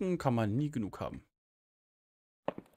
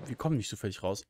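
ordinary delivery

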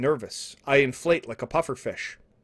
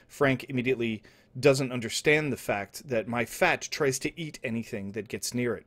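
usual speaking voice

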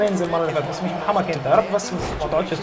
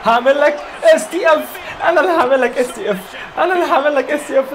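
laughing taunt; 8 semitones higher, 4 dB louder than usual. Real, not acted. Gamer laughing and taunting Cena on screen. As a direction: taunt the opponent through laughter.

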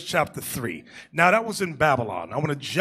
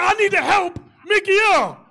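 much higher, louder faked eagerness; mock heroic shout; 6 dB louder than usual. Preacher retelling pretending to help Mickey, mock shout. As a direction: shout it like you're the hero.

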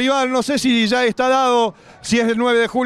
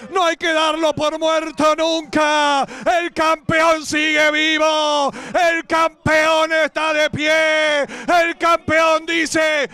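shouting, high and slow, euphoric commentary; football goal call; 3 semitones higher, slower than usual. Real, not acted. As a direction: celebrate a historic win on air. Winning penalty called live.

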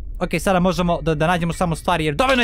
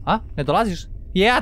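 normal talking voice

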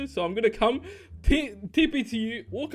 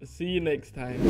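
ordinary talk

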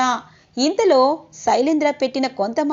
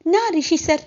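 overjoyed repeat; 4 semitones higher than usual. Acted. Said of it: "అని చెప్పి అలాగే చూస్తూ".

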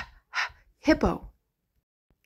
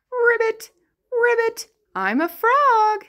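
high, chirpy ribbit; frog; 10 semitones higher than usual. Pretend. Teacher plays a frog for the children. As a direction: croak like a little frog.

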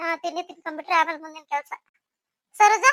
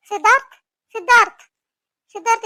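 loud, higher call to wake him; wife calling husband awake, loud; calling loudly; 4 semitones higher, 9 dB louder than usual. Acted. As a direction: call out to rouse a sleeper. Wife waking sleeping husband.

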